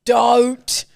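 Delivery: slow, drawn-out mock outrage; mock grave warning. Host scolds co-host for tuning out, mock grave. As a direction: forbid it with hammed-up, stretched words.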